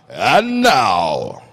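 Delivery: ringmaster announcement. Retold or imitated. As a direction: boom it like a circus ringmaster. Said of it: "practicing my".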